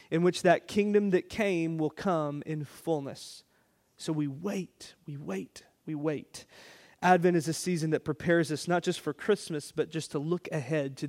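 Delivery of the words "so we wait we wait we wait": hushed. Acted. Hushed repeated waiting.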